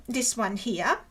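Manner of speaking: normal talking voice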